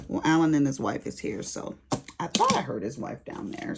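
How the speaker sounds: ordinary talk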